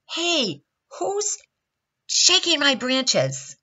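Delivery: high, drawn-out indignant cry; indignant protest. A narrator's reading. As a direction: demand to know who is bothering you. Tree disturbed while trying to sleep.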